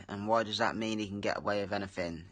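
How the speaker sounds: usual speaking voice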